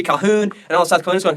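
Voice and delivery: ordinary delivery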